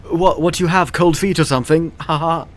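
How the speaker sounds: cocky mock jeer; mocking taunt. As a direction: tease him, cocky and amused. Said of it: "he's like".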